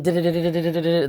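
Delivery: rapid-fire tongue drum roll; fast rolling "du-du" drum noise; imitated drum roll. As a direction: mimic drums building suspense. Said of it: "then the drums come".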